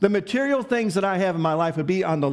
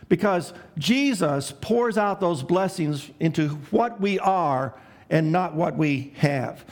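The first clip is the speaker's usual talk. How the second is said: usual speaking voice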